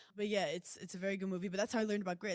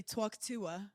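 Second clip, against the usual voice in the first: lightly put-on New York accent; 3 semitones lower, slower than usual. Shown as a demonstration. Showing how New Yorkers open the podcast, New York accent. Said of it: "New York, we say".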